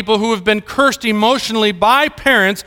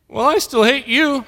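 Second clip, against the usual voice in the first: higher, a hint of stubborn child; lightly voiced little boy; 6 semitones higher than usual. Son answers loving dad, lightly voiced stubborn child. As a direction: lightly imitate a small son holding his ground. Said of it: "my youngest, and he goes".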